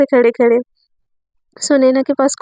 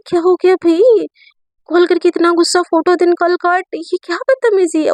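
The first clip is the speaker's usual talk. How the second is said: indignant rebuke; 5 semitones higher than usual. Acted. Teacher rebukes student for hanging up. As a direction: demand to know what this rudeness is. Teacher annoyed at being cut off.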